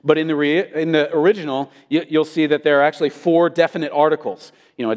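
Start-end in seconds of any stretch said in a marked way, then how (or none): none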